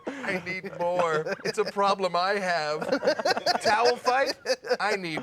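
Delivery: hammed-up plea, stretching the words out; comic bit, loud bursts of need; mock confession. Hammed-up confession played for laughs.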